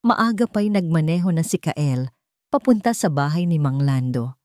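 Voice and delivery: ordinary delivery, nothing marked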